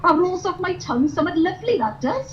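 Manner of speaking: put-on sing-song Welsh valleys accent; chirpy Welsh valleys girl. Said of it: "I'm from the valleys".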